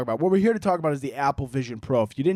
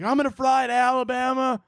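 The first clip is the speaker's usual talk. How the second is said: higher, worried mom voice; lightly voiced worried mom calling her son; mom freaking out; 9 semitones higher than usual. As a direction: mimic a panicked mother. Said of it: "She's like".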